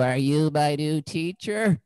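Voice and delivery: put-on slow, wide-eyed kid asking; hopeful child voice. Students looking up at their new substitute, slow hopeful child voice. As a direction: drag it out like a hopeful little kid. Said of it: "Exactly like that".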